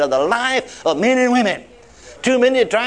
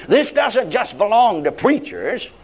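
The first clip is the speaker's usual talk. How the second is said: normal talking voice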